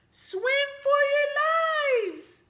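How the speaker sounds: very high, loud drawn-out wail; panicked shout. Acted. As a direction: scream it as you flee.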